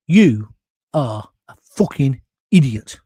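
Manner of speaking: loud, slow, deadpan put-down; blunt retold insult. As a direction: hit each word of the insult slowly and loudly. Friend bluntly insults him, retold.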